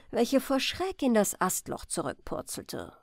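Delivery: usual speaking voice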